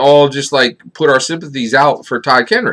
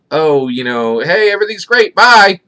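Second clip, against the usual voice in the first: higher, carefree fake cheer, bye punched; mock breezy goodbye; 6 semitones higher than usual. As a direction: toss it off like nothing happened. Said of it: "it does not look like he's like".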